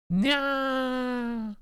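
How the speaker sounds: high cat-like shriek of fright; high startled yelp. Acted. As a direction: shriek in sudden shock. Startled by crashing glass.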